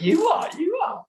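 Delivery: very high, mock-squeaky contradiction; high-pitched retold ribbing; teasing retort. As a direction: tease a mate in a comic falsetto. Mate mockingly contradicts him in anecdote.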